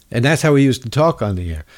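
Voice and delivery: usual speaking voice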